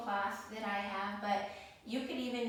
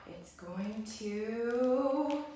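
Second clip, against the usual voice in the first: suspenseful drawn out; 6 dB louder, much slower than usual. Acted. Build-up before the pop.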